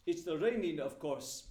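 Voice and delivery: put-on a light Russian accent on a deadpan answer; passable Russian accent. Official answering the couple, in a light Russian accent. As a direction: clip the words like a Russian official. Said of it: "in a very passable Russian accent".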